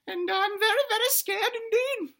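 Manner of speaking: high, squeaky scared little dino; loud falsetto worry from a tiny dinosaur; little dinosaur child. Acted. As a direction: squeal it like an anxious kid. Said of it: "And he says".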